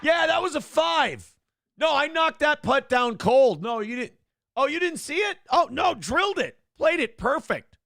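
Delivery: high-pitched, insistent mock bragging; boasting golfer. Golfer insisting to his partner he drilled the putt, mock boasting. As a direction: play a golfer hotly defending his score.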